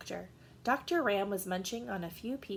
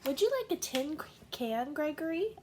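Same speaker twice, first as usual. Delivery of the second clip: higher, quick motherly goat offering food; 7 semitones higher than usual. Acted. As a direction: sweetly offer the tin can. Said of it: "asked Mother Goat".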